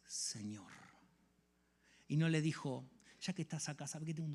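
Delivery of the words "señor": whispered Señor, hushed; awed whisper. Acted. Saulo meeting Jesus, whispering in awe. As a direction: say it barely above breath, humbled.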